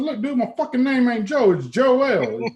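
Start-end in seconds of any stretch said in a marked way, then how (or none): 0.0-1.5 higher and a bit louder, annoyed; retelling how he snapped at the guy; irritated retort. Retold or imitated. Telling off a Chicago stranger, annoyed and raised.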